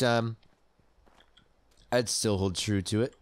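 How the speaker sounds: usual speaking voice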